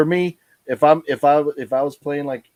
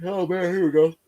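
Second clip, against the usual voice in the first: yawning; 7 semitones higher than usual. Real, not acted. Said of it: "Now I got yawning".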